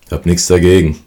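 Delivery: ordinary delivery, nothing marked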